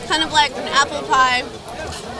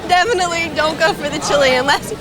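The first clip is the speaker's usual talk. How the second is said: normal talking voice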